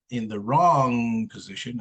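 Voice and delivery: ordinary talk, nothing marked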